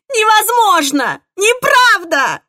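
slow, high drawn-out screams; screaming. A narrator's reading. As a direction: scream each word in despair. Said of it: "Я сорвалась на крик". (истошный крик)

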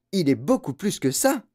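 high-pitched fervent admiration; exclaiming old man. A narrator's reading. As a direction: enthusiastic grandfather's praise. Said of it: "s'exclama le vieil homme".

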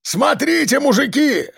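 high-pitched calling out; excited yell. A narrator's reading. Convict alerts the gang to the runaways, excited yell. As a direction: yell to the others at a sighting. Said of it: "заорал Сергеич".

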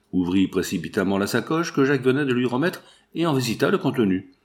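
ordinary delivery, nothing marked

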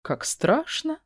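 mock fear, high and soft; sarcastic. A narrator's reading. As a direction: sneer it under your breath. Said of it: "Язвлю про себя".

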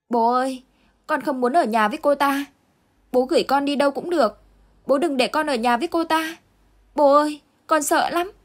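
put-on high, pleading little boy on the verge of tears; a scared boy begging his father not to leave him; whimpering child. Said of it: "mếu máo nói". (cậu bé mếu máo van nài bố, giọng cao)

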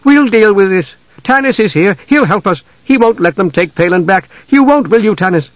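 high, anxious woman's voice; frightened pleading. A narrator's reading. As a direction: plead urgently, seeking reassurance. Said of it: "Her eyes were frightened, pleading".

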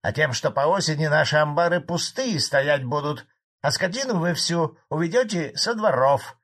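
high, loud peasant accusation; angry old farmer. A narrator's reading. Old farmer accuses the bosses of stripping the village, angry. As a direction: build the grievance loudly.

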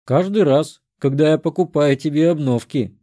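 usual speaking voice

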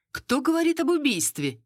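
high-pitched challenging question; cutting in. A narrator's reading. Madame cuts off talk of murder, challenging. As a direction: interrupt to shut down the talk. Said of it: "перебила её мадам Уэстбрук".

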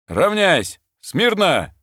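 high, loud parade-ground commands; shouting drill sergeant. Acted. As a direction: bark the drill commands at the ranks. Officer commanding a lined-up squad. (командирский крик на плацу)

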